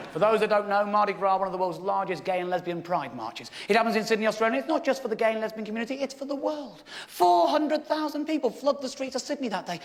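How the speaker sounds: ordinary talk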